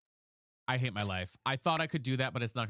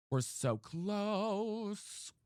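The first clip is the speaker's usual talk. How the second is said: drawn-out excitement; 7 semitones higher, much slower than usual. Real, not acted. Excited about ninety-eight percent.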